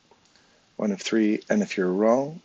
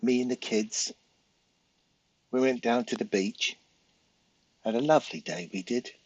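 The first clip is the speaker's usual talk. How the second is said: lightly put-on British accent, lifting on lovely; a chatty British mum back from the beach; a hint of a British holiday story; British tourist accent; 3 semitones higher than usual. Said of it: "When the Brits come in and with their accent".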